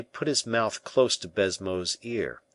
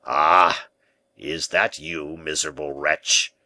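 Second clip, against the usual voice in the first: a loud "Ah!" then a slow scornful reproach; angry outcry; 4 semitones lower, 4 dB louder, slower than usual. Acted. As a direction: cry out at the prisoner in contempt. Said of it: "cried the governor".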